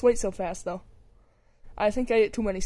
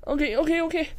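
normal talking voice

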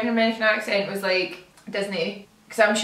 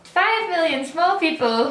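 playful small voice; 8 semitones higher, slower than usual. Pretend. Playful voice about tiny Scotland.

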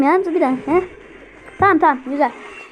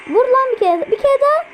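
excited high urging; 8 semitones higher than usual. Real, not acted. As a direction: pitch jumps high, urging the unit on. Urging unit in tense match.

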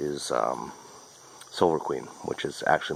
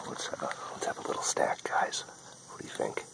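whisper; 8 dB quieter than usual. Real, not acted. Sneaking a snack, hushed aside.

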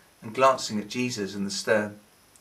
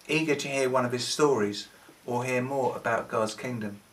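plain reading voice

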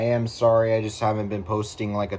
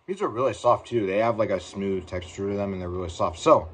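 talking while chewing candy; chewy mouthful of gummy; mouth full, chewing. Real, not acted. Seen 2: Gummy put in mouth at -1; talks while chewing, head down.

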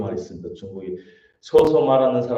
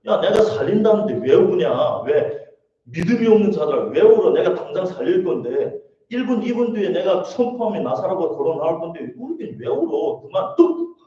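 high, loud, hammed-up telling-off; mock scolding; 6 semitones higher, 4 dB louder than usual. Jesus imagined scolding mourners for crying, mock exasperated. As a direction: scold the weepers in playful exasperation.

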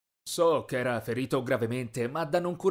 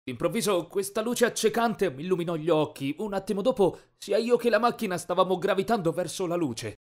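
ordinary delivery, nothing marked

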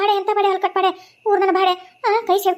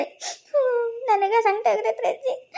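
softer, slow, sobbing through the words; tearful; 3 semitones higher, 4 dB quieter, much slower than usual. Acted. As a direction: choke up over the hurt child. Mother distressed over burned baby.

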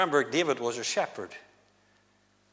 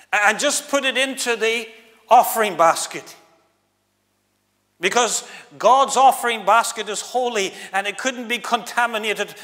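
self-righteous mockery; 7 semitones higher, 8 dB louder than usual. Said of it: "putting on that posh accent".

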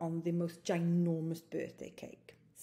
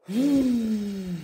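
sighing exhale; 3 semitones higher, 8 dB louder, slower than usual. Shown as a demonstration. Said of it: "Breathe out".

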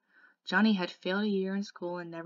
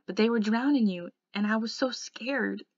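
raised, distressed confession; blurted out; 3 semitones higher, 6 dB louder than usual. A narrator's reading. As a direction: blurt it loudly, then draw out the fear. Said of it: "he finally blurted out".

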